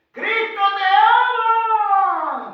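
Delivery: high, loud cry, held long; drawn-out shout. Acted. Preacher acting the pastor crying out, long shout. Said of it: "gritó".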